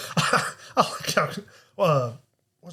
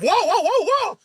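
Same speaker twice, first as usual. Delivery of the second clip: high, hammed-up startled whoa; mock not-so-scared whoa; 14 semitones higher, 4 dB louder than usual. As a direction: imitate a barely alarmed yelp. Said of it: "he doesn't actually sound terrified".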